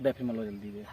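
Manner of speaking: low hushed hurry. Acted. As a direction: push him quietly. Urgent hushed buying.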